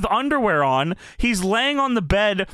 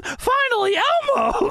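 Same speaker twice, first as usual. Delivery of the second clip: very high, squeaky puppet voice; Elmo. Acted. Elmo crawls into bed, high cartoon voice. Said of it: "Elmo crawls into bed".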